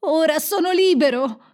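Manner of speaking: high, loud shout of freedom; elated high-pitched yell; jubilant cry. A narrator's reading. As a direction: shout it out in triumph. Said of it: "gridò Kai".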